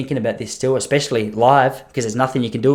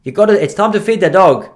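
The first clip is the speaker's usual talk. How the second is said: put-on European accent, slightly raised voice; mistaken European accent; 4 semitones higher, 5 dB louder than usual. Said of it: "He's not even European".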